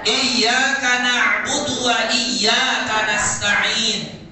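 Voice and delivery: Iyyaka na'budu drawn out slowly with the yy pressed hard; stressed double consonants. Shown as a demonstration. Teacher reciting to a class with pressed yy. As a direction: lean on the doubled y in iyyaka, slow and loud. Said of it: "cara bacanya harus ditekan".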